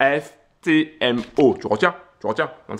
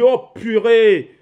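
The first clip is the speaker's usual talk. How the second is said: slow, loud, high amazed gasp; surprised exclamation; 7 semitones higher, 5 dB louder, slower than usual. Real, not acted. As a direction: blurt it out in disbelief. Watching trade climb, exclaims.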